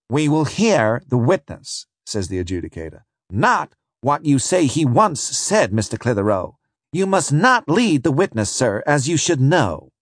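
a judge putting counsel in his place; stern adjudicator. Acted. Adjudicator rebukes prosecutor.

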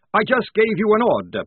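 high, loud rebuke; angry command. A narrator's reading. Maledict pulling rank on Polly, angry. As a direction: pull rank with sudden force.

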